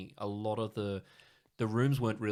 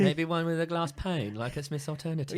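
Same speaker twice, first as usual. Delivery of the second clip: lampoon a picky punter asking for extras; lightly hammed-up demanding customer; mock fussy client; 8 semitones higher, 5 dB louder than usual. Mocking a fussy client's request.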